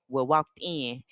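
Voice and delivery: ordinary talk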